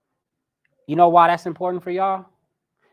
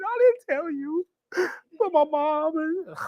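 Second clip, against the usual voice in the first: hammed-up sad whimper, much higher; mock tearful; 13 semitones higher than usual. Dove confesses sad news tearfully.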